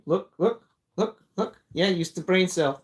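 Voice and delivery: coaxing the cat. Real, not acted. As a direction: get the cat's attention playfully.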